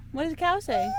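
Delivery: high sing-song question. Real, not acted. Repeats question to toddler.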